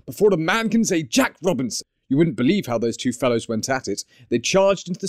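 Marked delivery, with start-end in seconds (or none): 0.0-1.9 higher, hearty urging; rally the lads in a jovial master's voice; merry, high-pitched order; jovial cry. A narrator's reading. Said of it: "cried old Fezziwig".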